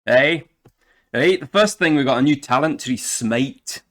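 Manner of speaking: put-on loud, high, broad Scottish accent. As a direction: ham up a thick Scottish brogue. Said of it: "we shall of course be doing the entire video in Scottish".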